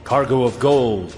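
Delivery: ordinary delivery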